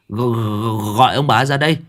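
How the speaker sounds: plain reading voice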